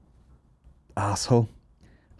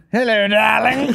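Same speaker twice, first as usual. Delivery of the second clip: higher, slower, drawn-out luvvie greeting; posh luvvie [name]; 11 semitones higher, 4 dB louder, slower than usual. Retold or imitated. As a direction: camp well-spoken darling voice. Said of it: "he doesn't talk like that at all".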